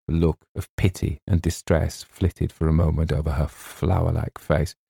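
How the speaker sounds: usual speaking voice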